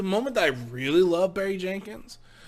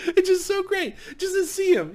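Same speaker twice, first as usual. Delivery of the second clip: ordinary talk, nothing marked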